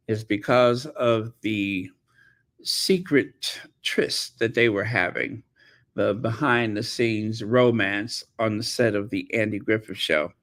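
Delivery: ordinary talk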